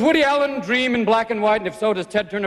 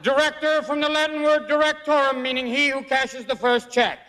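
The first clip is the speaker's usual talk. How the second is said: George Jessel; 4 semitones higher than usual. Acted. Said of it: "I will now channel the spirit of George Jessel".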